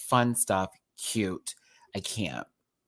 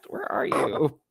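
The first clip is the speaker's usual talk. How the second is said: whisper; 5 semitones higher, slower than usual. Real, not acted. Looking for the cat.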